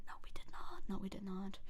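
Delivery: hushed whispered denial. Acted. Streamer secretly denying the joke to chat, whispered.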